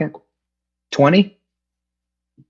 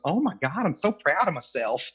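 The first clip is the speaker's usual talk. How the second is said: high, soft Southern-accented mimicry; 7 semitones higher, 7 dB quieter than usual. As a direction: mimic a proud little Southerner, high and hushed. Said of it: "this little Southern accent".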